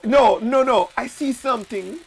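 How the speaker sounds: high mock-Jamaican fortune teller; hammed-up Jamaican seer; bad Jamaican accent. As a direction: divine the future in fake Jamaican. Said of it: "need to talk in a Jamaican accent".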